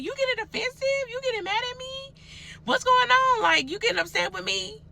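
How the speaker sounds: high, squeaky, teasing questions at a friend; mock high-pitched questioning. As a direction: pitch way up and needle him playfully. Retelling her own probing questions.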